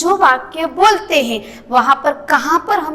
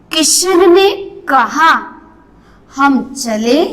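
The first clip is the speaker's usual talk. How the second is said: raised, slow Kishan ने कहा, long pause, हम चले; comma pause; 3 dB louder, slower than usual. Shown as a demonstration. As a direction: stop after कहा before the quote हम चले. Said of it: "वहां पर रुकना है".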